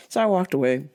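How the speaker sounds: ordinary delivery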